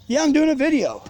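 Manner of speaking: annoyed shout; shouting back. Real, not acted. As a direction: tell him off from across the yard. Yelling back at someone nearby.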